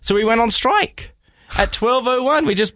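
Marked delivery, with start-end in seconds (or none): none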